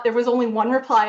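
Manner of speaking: usual speaking voice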